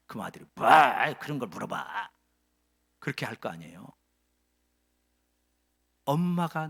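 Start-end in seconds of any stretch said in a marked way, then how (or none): 0.6-2.1 sullen son. Acted. Sullen son answers mother.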